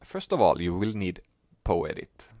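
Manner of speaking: normal talking voice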